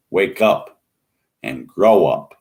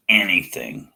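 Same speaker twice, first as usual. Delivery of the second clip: usual speaking voice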